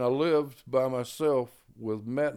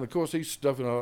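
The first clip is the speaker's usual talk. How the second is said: ordinary talk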